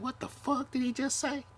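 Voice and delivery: squeaky high indignant voice; shrill up into a mock outraged squeak; outraged disbelief. Mocking how we react to offhand remarks, squeaky high outrage. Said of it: "and we be like".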